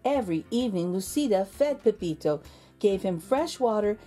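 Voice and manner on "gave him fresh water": ordinary delivery, nothing marked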